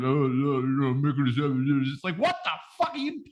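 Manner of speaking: mumbled, marble-mouthed Rocky, lightly done; slurred, a bag of marbles in his mouth; Rocky impression. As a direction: mumble like punch-drunk Rocky. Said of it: "sounded like a bag of marbles".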